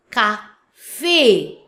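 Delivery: CAFE split "ka - FAY", second syllable long and loud; French cafe with a drawn-out é; French pronunciation. Shown as a demonstration. French teacher demonstrating the accent on E, French pronunciation. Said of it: "but in French".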